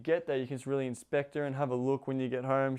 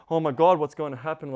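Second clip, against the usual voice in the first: mock panting, louder than usual talk; 8 dB louder than usual. Coach voicing the partner in suspense, mock panting. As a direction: play breathless suspense, lightly hammed-up. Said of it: "like, panting".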